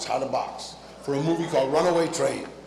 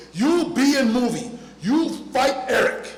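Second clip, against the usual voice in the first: slow, high, loud broken-English command; foreign director's orders; 5 semitones higher, 4 dB louder than usual. Acted. Director hiring Danny for the film, slow loud orders. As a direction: play an emphatic foreign director giving orders.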